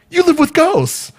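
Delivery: high-pitched, drawn-out disbelief; incredulous protest. Retold or imitated. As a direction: balk at it, voice shooting up and stretching out. Balking at entering haunted home.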